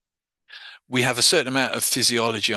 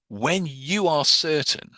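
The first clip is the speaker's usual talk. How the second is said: slow, raised, deliberate phrasing; slow suggestion; 5 semitones higher, slower than usual. Shown as a demonstration. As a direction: lean into it to presuppose. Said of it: "so I'm saying".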